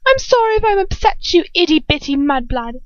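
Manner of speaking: high, sing-song false sweetness; mocking taunt. Bellatrix taunts the mudblood girl in a high mock-sweet voice. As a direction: coo the insult.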